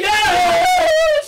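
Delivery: high, loud yes; goal yell. Real, not acted. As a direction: cheer the goal. Goal celebration.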